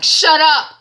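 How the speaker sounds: sharp shout. Retold or imitated. Mother cuts son off.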